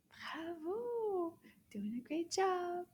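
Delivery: high, light sing-song cheer; high encouraging bravo; delighted praise. Real, not acted. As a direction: praise the learner in a lifted voice. Cheering the learner on.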